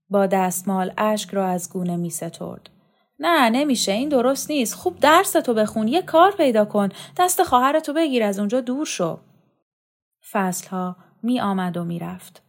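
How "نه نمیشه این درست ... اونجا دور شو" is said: put-on higher, urgent old man pleading, speeding up toward the end; earnest old man's counsel. Old man urging the boy to save his sister, pleading. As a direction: press the boy hard, like a worried grandfather.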